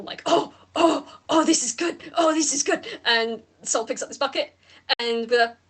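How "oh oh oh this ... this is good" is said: higher, eager oh oh; mild excited creature. Acted. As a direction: wriggle with glee. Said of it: "this is an excited wriggle".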